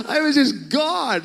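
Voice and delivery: high, loud, hammed-up crying voice; mock bawling. Preacher imitating himself overwhelmed by God's love, mock bawling. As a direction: cry it out as if overwhelmed. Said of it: "I just couldn't stop bawling".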